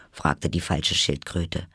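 ordinary delivery, nothing marked

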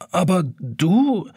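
drawn-out amazed question; boy can't believe the big clock talks; astonished boy. A narrator's reading. Jonas astonished.

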